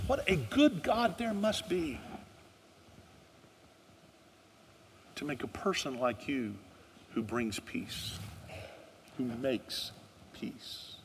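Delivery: slow, fading to a near whisper; awed hush. Acted. Onlooker marvelling at a peacemaker, slow and fading. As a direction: let it fall away in wonder. Quoted onlooker, sermon close.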